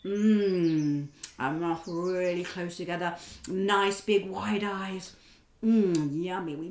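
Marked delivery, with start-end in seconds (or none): none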